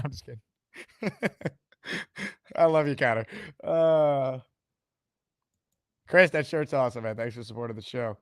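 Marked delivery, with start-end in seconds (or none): none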